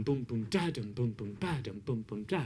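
low soft boom boom da; drum beat vocalised. Shown as a demonstration. Teacher showing students the strum as drum sounds. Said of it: "think of it as a drum pattern".

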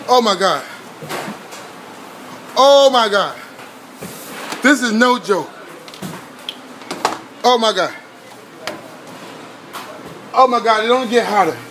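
usual speaking voice

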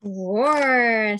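a playful storybook roar; mock lion roar. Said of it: "said the lion".

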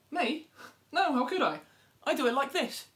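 a smug little bird protesting her innocence; innocent animal voice. Acted. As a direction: play the dove, shocked at the idea. Hare denies it, shows how.

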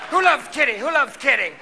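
high, loud camp cat voice; drag-queen cat. Acted. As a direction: demand adoration like a diva cat. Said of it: "are cats drag queens?".

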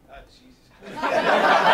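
slow breathy laughing oh Jesus; laughing groan. Real, not acted. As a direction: laugh it out, defeated.

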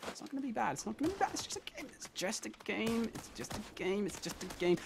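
barely audible mantra; forced calm murmur. Real, not acted. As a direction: soothe yourself through clenched frustration. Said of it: "I'm focused".

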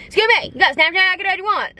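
high, fast mocking of the guy's line. Telling the guy how not to talk to a girl, mocking. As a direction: rush it out mocking his awkward ask. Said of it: "You don't just go".

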